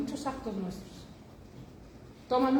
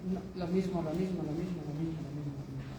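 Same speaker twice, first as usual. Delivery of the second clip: "lo mismo" repeated, lower and softer each time, dying away; fading repetition; 5 semitones lower, 4 dB quieter than usual. Acted. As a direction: let the repeated phrase sink into a monotonous fade. Mimicking endless routine. (repetición monótona que se apaga)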